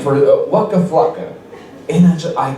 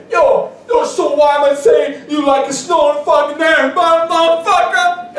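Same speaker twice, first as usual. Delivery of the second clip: high shouted rapper insult; mock rapper diss; 10 semitones higher than usual. Street rapper taunts comic before crowd, shouted mock voice. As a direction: roast him in front of the crowd. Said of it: "and he was like".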